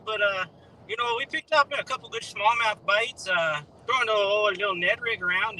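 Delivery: Al Linder, Canadian twist. Acted. Guest doing a requested fishing report, Canadian-accented impression. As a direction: talk up the fishing like a folksy Canadian angler. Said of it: "give us Al Linder with a little bit of Canadian twist".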